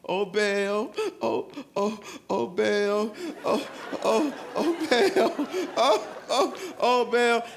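hammed-up worn-out chanting, rising as it goes; mock laboured prophets calling their god; limping prophets of Baal. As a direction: pant out the chant like limping worshippers.

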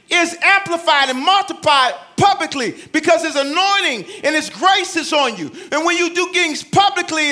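loud, high, driving preacher; full-force preaching. Acted. Pastor urging the church to pray in private, loud and high. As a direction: preach it out to the congregation.

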